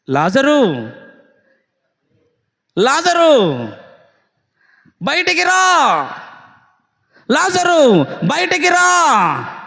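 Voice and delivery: loud, slow, drawn-out call; Jesus summoning Lazarus from the grave, loud and slow; Jesus commanding Lazarus. Acted. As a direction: call him out of the tomb, loud and stretched. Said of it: "ప్రభు అంటున్నాడు".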